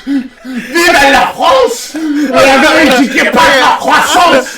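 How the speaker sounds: rousing mock-French rallying cry; hammy French accent. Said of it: "Stop the accent!".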